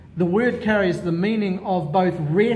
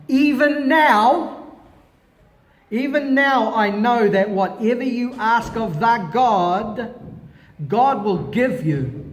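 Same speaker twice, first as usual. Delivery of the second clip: fervent plea; 5 semitones higher, 5 dB louder than usual. Acted. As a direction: plead it out, stretching the first even now. Voices Martha's plea of faith.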